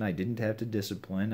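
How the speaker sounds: ordinary talk, nothing marked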